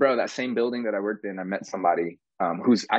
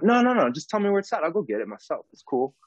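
higher, flustered no-no-no; bashful refusal; 8 semitones higher than usual. Retold or imitated. Turning down a drink from set staff, flustered and higher. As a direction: wave off the fuss, embarrassed.

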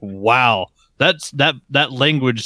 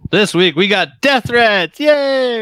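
slow build, then a high sarcastic "Yay!"; mock cheer; 9 semitones higher, much slower than usual. Co-host opens with death threats, sarcastic cheer. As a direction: fake delight at bad news.

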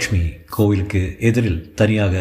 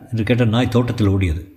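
usual speaking voice

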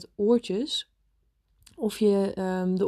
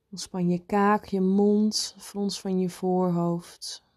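guided meditation. Acted. Leads a meditation.

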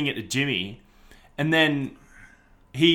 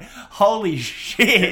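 slow, drawn-out higher holy shit; amazed; 6 semitones higher, 4 dB louder, much slower than usual. Retold or imitated. Chop seeing the video of himself, slow and stunned. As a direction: stretch it out in stunned wonder.

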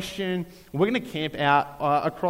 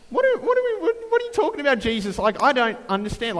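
much higher, squeaky mock-confused voice; baffled follower; 9 semitones higher, 4 dB louder, faster than usual. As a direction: play a bewildered listener, pitch way up. Mock bewildered follower questioning Jesus.

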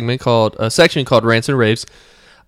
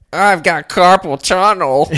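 slow, high whiny coworker; 7 semitones higher, 4 dB louder, slower than usual. Retold or imitated. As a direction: whine it out. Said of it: "people are like".

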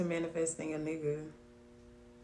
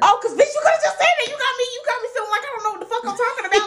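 high, loud and fast through laughter; loud laughing outburst; 16 dB louder, faster than usual. Real, not acted. Cracking up at her friend for dodging the question, high and loud. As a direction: squeal it out laughing in disbelief.

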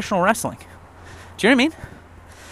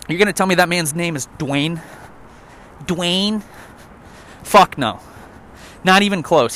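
ordinary talk, nothing marked

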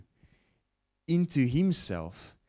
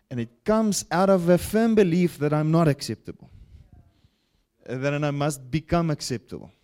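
loud, raised preaching voice; preaching at full force; 5 semitones higher, 7 dB louder than usual. Real, not acted. Pastor pressing his congregation, loud and forceful. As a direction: drive the point home loudly.

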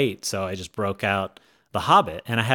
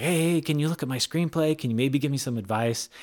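higher-pitched, eager excited request; 6 semitones higher than usual. Retold or imitated. Writer excitedly asking a screenwriter to read the screenplay, eager. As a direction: re-enact an eager newcomer asking a favour. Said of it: "I was all excited".

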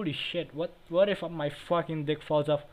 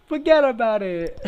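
mock New York; 6 semitones higher, 10 dB louder than usual.

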